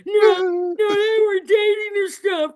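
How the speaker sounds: high, loud gossiping, half laughing; playful imitation of gossipers; mocking gossip voice. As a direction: ham up the nosy old friend. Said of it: "people that knew you back then".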